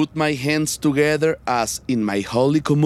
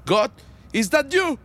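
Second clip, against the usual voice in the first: startled question; 9 semitones higher than usual. Acted.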